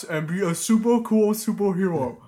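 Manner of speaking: loud, booming cartoon ogre read. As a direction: bellow it like a dopey ogre. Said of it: "I was trying to do an ogre voice".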